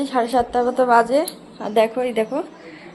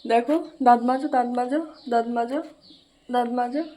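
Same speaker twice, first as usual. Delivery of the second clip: soft coaxing; 5 dB quieter than usual. Real, not acted. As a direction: coax the little one, softly. Mother coaxing child to brush.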